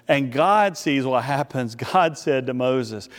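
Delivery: ordinary delivery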